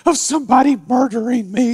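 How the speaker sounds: high, hammed-up frightened squeal; mock frightened girl. Pastor mocking the scared girl on TV, high and hammed-up. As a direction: mimic her panic in a high mock voice. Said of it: "she's freaking out".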